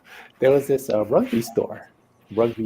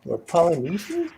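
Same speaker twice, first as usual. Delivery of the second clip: high-pitched, retold disbelief; incredulous realisation; 11 semitones higher than usual. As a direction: act out the penny dropping. Retold realisation of being mistaken.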